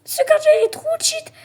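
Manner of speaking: very high, loud, fast squeal of outrage; hammed-up high-pitched whine; comic shriek. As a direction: shriek it like a cartoon tantrum. Shrieking at the goalkeeper.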